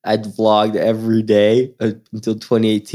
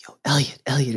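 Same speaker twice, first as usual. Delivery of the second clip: hushed dad; 3 semitones higher, 5 dB quieter than usual. Retold or imitated. Dad nudging his small son at the game, hushed. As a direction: quietly get your kid's attention.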